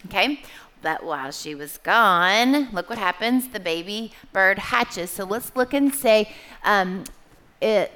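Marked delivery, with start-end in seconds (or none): none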